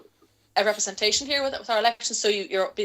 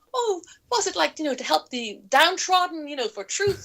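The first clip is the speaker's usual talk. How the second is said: mock naive; 6 semitones higher than usual. Her own naive quoted question.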